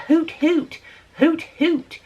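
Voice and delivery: mock the neighbor's clumsy hoot; wrong owl call. Mocking the neighbor's wrong owl call, clipped hoots. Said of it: "that's not what the owl says".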